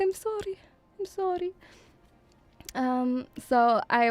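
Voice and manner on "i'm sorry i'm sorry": meek apology. Retold or imitated. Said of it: "I'm like".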